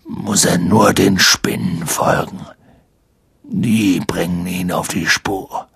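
rough, low big-man voice; gravelly, deliberate hint with pauses; clearly audible. A narrator's reading. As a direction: announce it pointedly, phrase by phrase. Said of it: "sagte deutlich vernehmbar".

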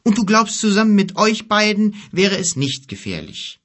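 high, loud, incredulous question; sceptical youth. Acted. Boy doubting the two companions' protection, sceptical.

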